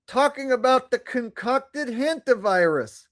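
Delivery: squeaky improvised gunslinger; Johnny Ringo impression. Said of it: "have a similar voice".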